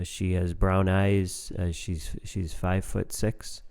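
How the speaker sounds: low, slow, dull listing of facts; calm, boring description. Retold or imitated. Husband describing his wife with no feeling, flat and bored. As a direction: drone it out like reading a spec sheet. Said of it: "And you just very calmly say".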